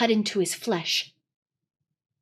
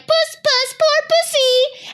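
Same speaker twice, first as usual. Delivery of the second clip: much higher, slow mock sweet cooing; jeering; 4 dB louder, slower than usual. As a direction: coo at him like a cruel tease. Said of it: "jeering at him".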